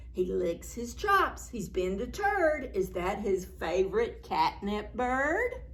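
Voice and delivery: plain reading voice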